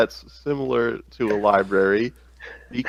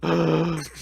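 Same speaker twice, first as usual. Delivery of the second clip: gasp; 7 semitones higher than usual. Real, not acted. Surprised gasp at good news.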